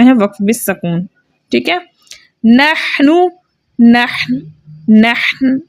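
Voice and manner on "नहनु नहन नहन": नहनु then नहन with a stop on न; word drill demo. Shown as a demonstration. Teacher drilling the word for students. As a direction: drill the word and its pause form. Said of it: "हा के मखरज में जाकर".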